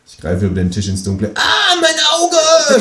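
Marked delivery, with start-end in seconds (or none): 1.3-2.8 high, very slow, loud kangaroo scream. Acted. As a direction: drag out a hammed-up pained yell. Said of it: "schreit das Känguru".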